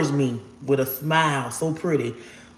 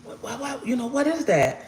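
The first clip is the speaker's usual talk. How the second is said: high, hushed puzzled thought; thinking to herself after seeing the angel, hushed and high; bewildered inner voice; 7 semitones higher, 6 dB quieter than usual. Retold or imitated. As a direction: wonder quietly to yourself. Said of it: "in my mind, I'm like".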